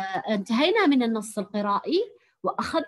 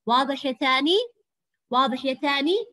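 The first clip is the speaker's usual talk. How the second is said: normal talking voice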